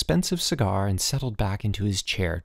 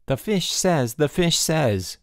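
sing-song mimicking, higher; mocking mimic; 6 semitones higher, slower than usual. A narrator's reading. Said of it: "Bruno mimicked".

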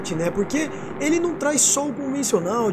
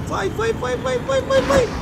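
urgent urging; 13 semitones higher, slower than usual. Real, not acted. Urging on against timer.

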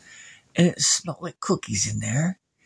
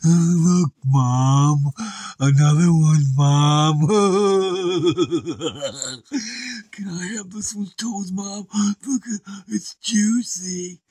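dim brute son; 4 semitones higher, slower than usual. Acted. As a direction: gloat like a dopey giant. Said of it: "that weird guy ... he said".

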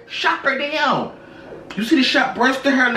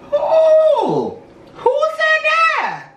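very high, drawn-out squeal of disbelief; mock startled shriek; 3 dB louder, much slower than usual. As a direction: squeal it high and loud, stretching it out. Mock startled reply to the quote.

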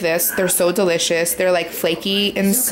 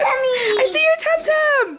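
playful squeal; slower than usual. Acted. Tickling kid, high playful shout.

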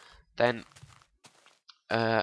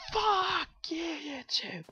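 squeaky high mock cheer, trailing off; much slower than usual. Mocking YouTube's upload limit with a squeaky high cheer. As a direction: fake a tiny sarcastic squeal of joy. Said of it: "And then I just go".

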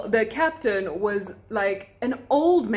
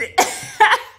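high, loud breathy laugh; giggling burst at the flirting; burst of laughter; 12 semitones higher, 8 dB louder than usual. Real, not acted. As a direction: laugh out in delighted disbelief. Reacting to the lady's flirting.

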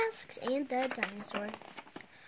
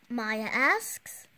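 usual speaking voice

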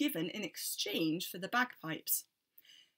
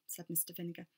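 plain reading voice

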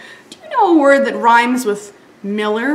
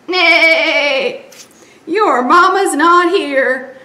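louder slow neighing horse; 5 semitones higher, 6 dB louder, much slower than usual. Acted. Horse telling piglet, neighing. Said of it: "said Horse".

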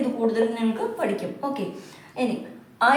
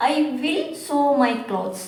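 slow example reading; much slower than usual. Shown as a demonstration.

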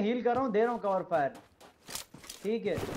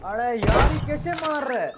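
loud, higher disbelieving cry; exasperated outburst; 4 semitones higher, 5 dB louder than usual. Real, not acted. Shocked at enemy's kills.

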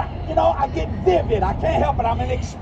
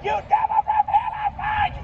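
hollering. Retold or imitated. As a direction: yell the rebuke at the demon, pitch way up. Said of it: "So I'm hollering at her".

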